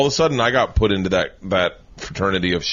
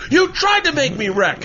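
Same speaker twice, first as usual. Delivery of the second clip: higher, aggrieved accusation; mock accusing whine; 3 dB louder than usual. Bobby accusing the driver, mock aggrieved. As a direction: whine it like a sore loser. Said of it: "And he goes".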